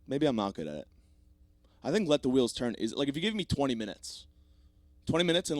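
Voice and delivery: ordinary talk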